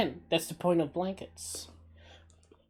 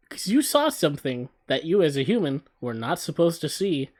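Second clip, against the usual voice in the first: usual speaking voice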